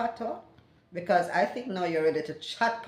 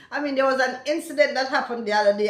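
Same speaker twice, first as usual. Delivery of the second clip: ordinary talk, nothing marked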